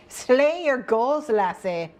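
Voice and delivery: slow, put-on Scottish pirate lilt; mock Scottish accent. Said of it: "I need to do that accent".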